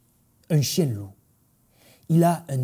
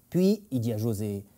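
usual speaking voice

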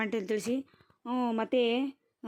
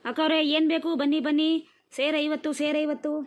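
higher-pitched hawker calling to customers; vendor calling out; 5 semitones higher, 5 dB louder than usual. Acted. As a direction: call out the price like a street seller. Street vendor calling out prices. (ವ್ಯಾಪಾರಿಯಂತೆ ಕೂಗಿ ಕರೆಯುವ ದನಿ)

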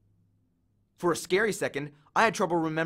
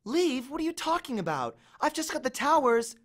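put-on higher, irritated girl's voice; annoyed girl; 9 semitones higher than usual. A narrator's reading. Annabeth annoyed at Percy for interrupting her game. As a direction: brush him off, annoyed at the interruption.

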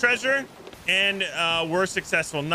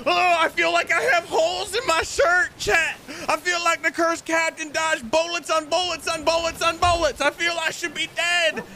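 adrenaline shakes; 6 semitones higher than usual. Real, not acted. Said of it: "my hands are shaking".

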